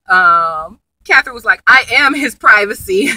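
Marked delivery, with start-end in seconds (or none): 1.7-3.1 louder, higher imitation of a firm comeback; playfully imitated territorial wife; mock assertive wife. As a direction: deliver it as a retold put-down, loud and emphatic. Retold wife shutting down the professor.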